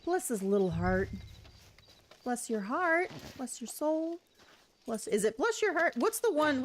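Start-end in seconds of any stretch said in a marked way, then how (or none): 0.0-4.2 1 Southern. Retold or imitated. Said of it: "what's the southern?".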